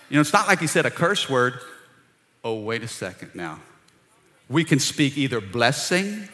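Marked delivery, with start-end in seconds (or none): 2.4-3.6 sudden low, soft interjection; dropped hush. Real, not acted. A preacher hushes the crowd, low and soft. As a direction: drop to a near hush to stop them. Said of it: "preacher interjects, hushed".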